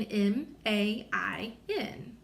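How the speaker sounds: letters spaced out slowly; slow spelling. Shown as a demonstration. Teacher to class, spelling man letter by letter. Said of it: "So we spell main".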